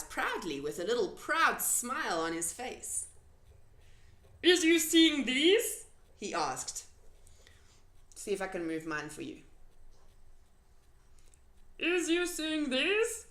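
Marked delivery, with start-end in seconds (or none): 4.4-5.9 slow, louder, proud question; BFG voice. A narrator's reading. The giant proudly showing Sophie his ears. 11.8-13.3 slow, higher proud question; BFG voice. A narrator's reading. The giant proudly showing Sophie his ears.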